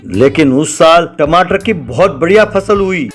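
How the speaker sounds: plain reading voice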